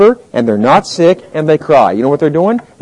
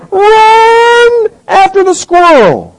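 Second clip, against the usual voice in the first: high, slow, shouted command; stretched high cry of a dog's instinct; dog's urge; 13 semitones higher, much slower than usual. Acted. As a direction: bark it out like the dog's sudden impulse. The dog's impulse voiced.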